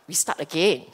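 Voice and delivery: usual speaking voice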